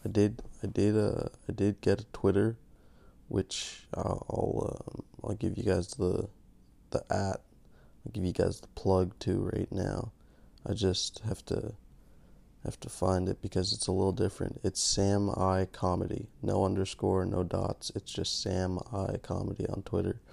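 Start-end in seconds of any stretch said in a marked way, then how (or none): none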